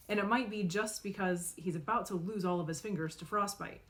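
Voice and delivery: usual speaking voice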